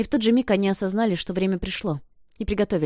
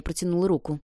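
plain reading voice

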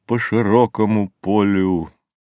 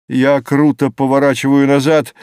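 usual speaking voice

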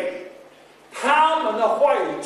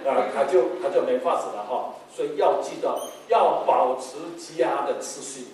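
ordinary talk

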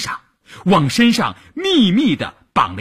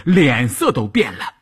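plain reading voice

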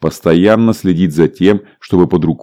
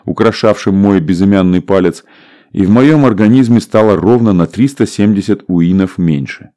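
usual speaking voice

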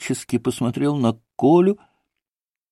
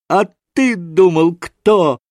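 bright, triumphant exclamation, mildly raised; ringing happy shout; 3 semitones higher, slower than usual. A narrator's reading. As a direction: call it out with ringing joy. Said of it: "Звонким счастливым голосом изо всей силы завопил".

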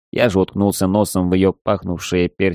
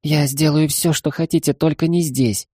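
begging maid voice; 7 semitones higher than usual. Acted.

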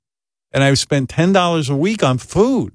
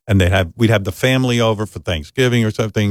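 ordinary talk, nothing marked

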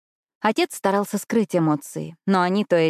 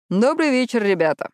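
dismissive; 5 semitones higher than usual. A narrator's reading. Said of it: "с пренебрежением ответил отец".

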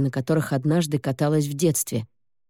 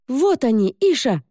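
put-on excited girl; 7 semitones higher than usual. Girl spots the berries.

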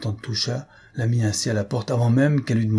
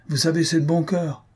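mildly joyful, higher-pitched aunt; 7 semitones higher than usual. A narrator's reading. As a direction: say it gladly, pitch lifted. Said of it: "dit la tante joyeusement".

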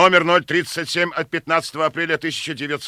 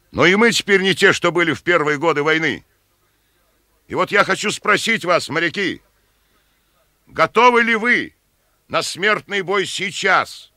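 higher, louder rallying call; rousing speech; 4 semitones higher, 5 dB louder than usual. Acted. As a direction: stir the men before battle. Captain rallies sailors before battle.

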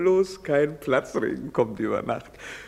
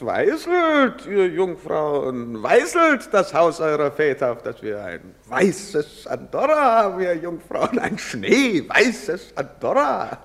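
loud, mock-solemn call to the maidens; hammed-up herald, higher and louder; mocking proclamation; 5 semitones higher, 6 dB louder than usual. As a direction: proclaim it like a mocking town crier.

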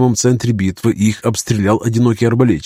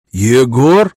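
muffled voice inside helmet; 2 semitones higher, 4 dB louder than usual. Acted. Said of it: "удивлённый глухой голос из-под шлема, словно человек говорил в ведро".

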